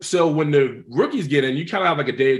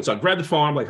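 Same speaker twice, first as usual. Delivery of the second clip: usual speaking voice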